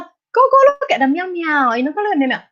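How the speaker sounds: put-on lightly high cooing baby talk on "meow meow". Shown as a demonstration. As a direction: point out the cat like talking to a toddler. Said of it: "That's baby talk".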